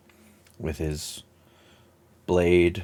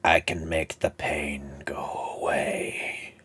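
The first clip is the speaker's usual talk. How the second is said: slow, hushed, drawn-out villain's whisper; Palpatine; 2 semitones lower, much slower than usual. Acted. Game master as villain tempts player, slow whisper. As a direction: tempt in a slow, creaking hush. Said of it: "shit, Palpatine's back".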